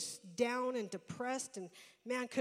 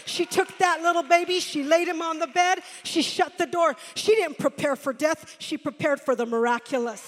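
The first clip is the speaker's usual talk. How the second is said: loud, higher preaching; preaching at full force; 5 semitones higher, 14 dB louder than usual. Acted. Preacher tells of the mother's faith, loud preaching. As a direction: proclaim it with rising fire.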